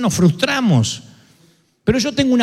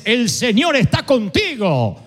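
slow and stately, a divine salute; God greeting a frightened man, slow and grand; slow solemn greeting; much slower than usual. Acted. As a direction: draw out "guerrero valiente" with weight. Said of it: "el saludo de Dios es".

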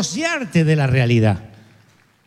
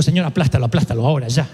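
joking plea; 7 semitones lower, much faster than usual. Retold or imitated. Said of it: "yo que Moisés digo".

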